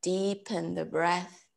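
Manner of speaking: slow, measured meditation voice with long pauses; guided breathing. Real, not acted. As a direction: count them calmly through each breath, unhurried. Leads breath counting and meditation.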